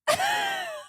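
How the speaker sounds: squealing laughter; helpless high giggle; high-pitched laugh. Real, not acted. Laughing at her own retelling.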